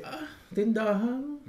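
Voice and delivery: slow, high, dismissive "Ah, tindahan"; mock a snob sneering at a small store; condescending drawl. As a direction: stretch it out to belittle the shop. Snob belittling his shop.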